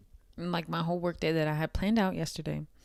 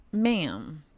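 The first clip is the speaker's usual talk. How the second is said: ordinary talk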